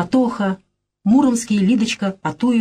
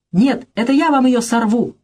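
кричит; 6 semitones higher than usual. A narrator's reading. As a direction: hurl it back at him as a challenge. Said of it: "Нелькин кричит". (Нелькин в ярости кричит Кречинскому)

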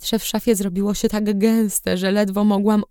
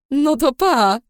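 cheerful goodbye; 8 semitones higher, slower than usual. A narrator's reading.

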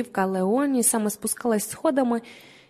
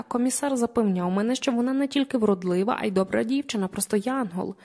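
plain reading voice

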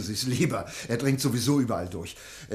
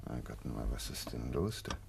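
hushed alarm; 11 semitones lower, 11 dB quieter than usual. Acted. Doctor in the stalled car, hushed and uneasy. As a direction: murmur it in uneasy dismay. Car won't start in the dark.

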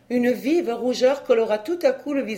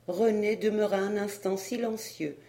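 ordinary delivery

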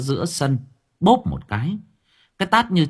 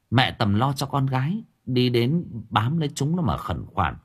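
usual speaking voice

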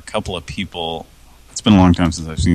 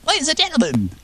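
high showman announcement; announcer voice; much faster than usual. Pretend. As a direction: introduce the act like a host. Announcing like a host.

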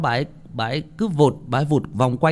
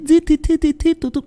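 high, squeaky stuttered whimpers; tiny repeated high-pitched sniffle sounds; imitated whimpering sobs; 4 dB louder than usual. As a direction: mimic a child too spent to cry. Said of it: "nó khóc không còn sức".

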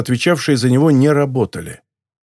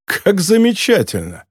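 higher-pitched sarcastic delight; mock admiration, dismissively; scornful snort; 7 semitones higher than usual. A narrator's reading. Yankee scoffs at the ex-soldier's boast, scornful. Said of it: "фыркнул Янки".

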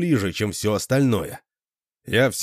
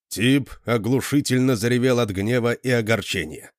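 plain reading voice

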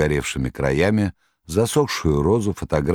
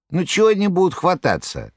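much higher jester voice; Koroviev; 14 semitones higher than usual. Acted. Koroviev reassuring the master, high clownish voice. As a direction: jeer like a clownish trickster.

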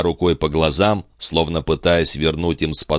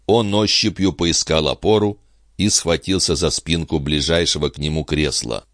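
plain reading voice

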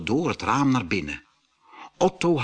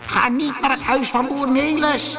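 trolls calling; 13 semitones higher, 4 dB louder than usual. A narrator's reading. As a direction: call across the mountains like trolls. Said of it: "hoorden ze de trollen naar elkaar roepen".

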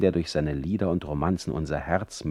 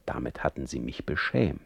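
ordinary delivery

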